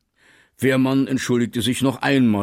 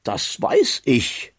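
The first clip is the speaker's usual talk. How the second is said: slow, amused, smiling voice; 3 semitones higher, much slower than usual. A narrator's reading. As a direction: let the smile be heard, drawn out. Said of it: "sagte er lächelnd". (lächelnd und langsam, amüsiert)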